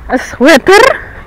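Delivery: drawn-out surprised question. Real, not acted. Surprised at finding a sweater.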